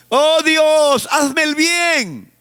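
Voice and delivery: high, slow, pitiful whine; mock pleading, stretched out slowly; whiny beggar prayer. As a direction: beg God like a helpless victim.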